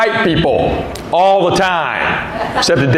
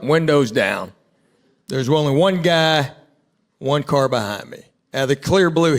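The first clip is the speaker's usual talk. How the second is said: ordinary talk, nothing marked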